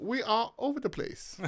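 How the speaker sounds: high, put-on commercial announcer voice; mock ad slogan. Echoing the Mister Chow's ad slogan in a high mock voice. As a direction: quote the slogan like a cheesy ad.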